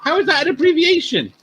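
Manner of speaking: high-pitched, disbelieving question; bantering, incredulous query; incredulous challenge. Real, not acted. Teasing argument over the abbreviation.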